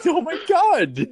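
loud, high, drawn-out disbelief; shocked outburst. Real, not acted. Friend hears about the flooded bathroom, shocked outburst. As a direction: cry out in amused shock, stretching it.

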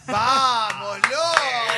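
loud excited shout; cheering shout. Real, not acted. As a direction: shout it with joy. Cheering the Maradona audio.

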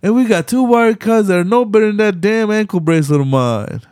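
high hammed-up Roman rant; mock Roman rant.

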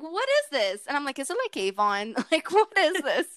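high-pitched, laughing bafflement; a lightly hammed-up what-is-this; retold puzzlement. Host telling guest she kept seeing the product, amused high-pitched puzzlement.

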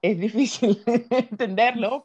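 slow, laughing through the words. Real, not acted. Laughing about struggling to understand English. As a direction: laugh it out while admitting difficulty.

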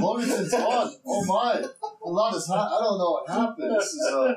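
high-pitched, flustered villager voice; bewildered dwarf. Acted. Dwarf told home is gone, high bewildered voice. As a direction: react to lost home as a dwarf, pitched well up.

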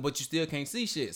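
ordinary talk

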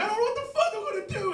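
frantic, rapid high-pitched screeching; panicked yelling. Retold or imitated. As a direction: shriek it out in a reenacted panic. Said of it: "I was just screaming and yelling".

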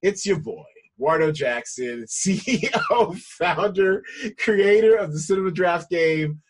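loud, drawn-out show host intro; big radio-host welcome; hype intro. Acted. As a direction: pump up the crowd as you open the show. Host opens the podcast show.

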